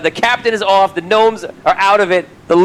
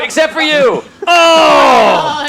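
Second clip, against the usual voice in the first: loud, higher exclamation; excited shout; 8 semitones higher, 4 dB louder, much slower than usual. Acted. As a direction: GM describes the boat launching into the air, excited shout. GM narrates boat flying out.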